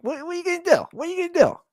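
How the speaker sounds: very high squeaky what-can-you-do; resigned shrug. Retold or imitated. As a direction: toss it off in a high silly voice. Shrugging at chaos.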